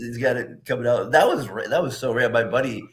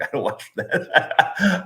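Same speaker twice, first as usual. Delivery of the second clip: laughing; 9 semitones higher, much slower than usual. Retold or imitated. Retelling reply to his coworker.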